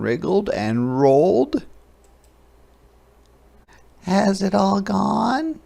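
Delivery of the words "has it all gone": high little hopeful question; high hopeful hedgehog voice. A narrator's reading. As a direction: ask hopefully as a tiny hedgehog. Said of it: "she asked hopefully".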